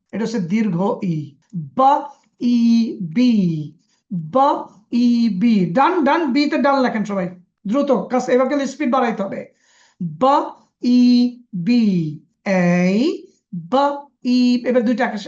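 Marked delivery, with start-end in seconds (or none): 1.5-5.7 বা ই বি drawn out very slowly; slow phonics reading. Shown as a demonstration. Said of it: "টেনে বলতে হয়". 10.0-12.2 বা ই বি drawn out very slowly; slow phonics reading. Shown as a demonstration. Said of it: "একসাথে বলেন". 13.5-14.6 বা then ই held long; long ই drawn out. Shown as a demonstration. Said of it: "একসাথে বলেন".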